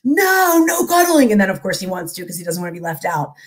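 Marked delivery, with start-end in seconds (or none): 0.0-1.3 high-pitched mock refusal; drawn-out kid protest, pitched up; protesting little boy. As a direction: object like a kid who wants no part of it. Voicing her son refusing cuddles.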